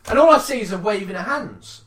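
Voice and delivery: ordinary delivery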